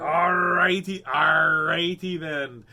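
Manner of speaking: loud, drawn-out pirate growl of "arr"; big, slow playful pirate. Pretend. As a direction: stretch it out like a hammy pirate. Playing pirate before pirate books.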